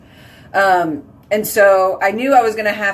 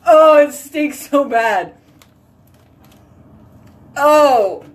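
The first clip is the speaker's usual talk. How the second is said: loud, slow, disgusted groan; reeling from the stench; disgusted by smell; 5 semitones higher, 4 dB louder, slower than usual. Real, not acted. Dog poop smell in bathroom.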